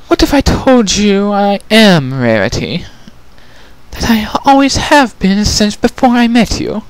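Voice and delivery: high, refined and genteel lady; Rarity's voice. Acted. Changeling prisoner calls out to Twilight, refined and high.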